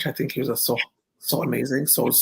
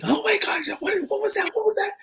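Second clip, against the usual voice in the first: much higher, mock shouted disbelief; excited shout. Designer exclaiming over the runway kiss, much higher shout. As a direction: shriek it in amazement. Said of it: "Gaultier's, like, going on".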